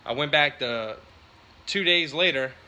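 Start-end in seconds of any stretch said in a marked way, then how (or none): none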